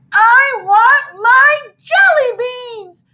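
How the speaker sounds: high, loud, slow wail. Acted. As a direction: wail like a kid in a tantrum. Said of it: "wailed Ham".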